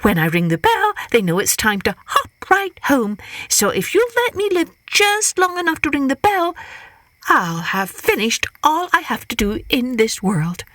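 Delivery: high, sly hare explaining. Acted. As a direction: spin the tale sweetly as Hare. Hare tricks Coyote.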